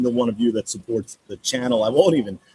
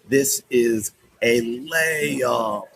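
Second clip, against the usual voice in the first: very slow, stretched "layup"; drawn-out emphasis; much slower than usual. Real, not acted. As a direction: stretch out every word, especially LAYUP. Drawn-out emphatic repeat of the line.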